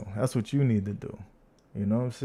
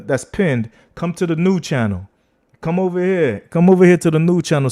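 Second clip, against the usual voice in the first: loud call-out; 5 semitones higher, 10 dB louder than usual. Real, not acted. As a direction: yell across the room to get them over. Calling viewers to the link.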